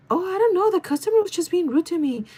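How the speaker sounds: high-pitched mock innocent excuse; coworker's put-on voice. Coworker explaining the customer incident, put-on innocent voice. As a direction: imitate her in a high, fake voice. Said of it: "that's the voice she makes".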